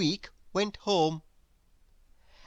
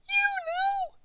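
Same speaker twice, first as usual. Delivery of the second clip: very high tiny squeak of a mouse; squeaky mouse voice. Acted. Squeak the mouse calling to Grizzle, very high squeak.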